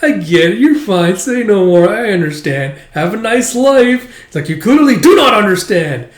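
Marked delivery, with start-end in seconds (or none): 0.0-4.1 raised, louder mock understanding, slowing at the end; smiling dismissal. Akane smiling off the explanation, mock understanding. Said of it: "like, smiling". 4.5-6.1 loud, raised-pitch rebuttal; mock exasperated. Character correcting Akane's assumption, mock exasperated.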